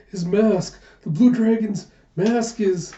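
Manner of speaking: high, loud cry of alarm; panicked villager. A narrator's reading. Villagers see the dragon's mask fallen off, panicked. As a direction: play a villager starting to panic. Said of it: "are panicking as they go".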